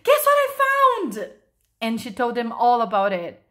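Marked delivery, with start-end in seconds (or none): 0.0-1.3 very high, loud call; excited shout. A narrator's reading. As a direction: shout big news to friends. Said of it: "she shouted".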